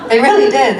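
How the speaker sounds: laughing through the words. Real, not acted. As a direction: giggle as you say it. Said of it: "laughing while telling".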